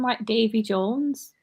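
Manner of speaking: slow, broad Wigan vowels on Davy Jones; a real Wigan slip, drawn out; slipped into Wigan. Real, not acted. Friends notice her Wigan accent slip out. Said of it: "That was a bit Wigan".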